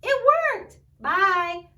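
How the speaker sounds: high, loud cry of joy; thrilled. Acted. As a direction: burst out with delight. Elephant thrilled birds leave.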